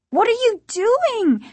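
put-on very high, panicked girl's cry; frantic high child's voice; alarmed repeat. A narrator's reading. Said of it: "she repeated".

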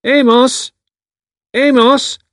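calling out loud across a field; shouting. A narrator's reading. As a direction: shout the name to someone far off. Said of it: "raised both hands to her mouth and shouted".

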